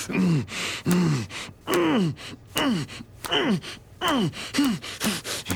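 high rapid moaning. Acted. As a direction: moan high at the peak. Lover moaning near climax.

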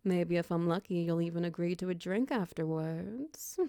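normal talking voice

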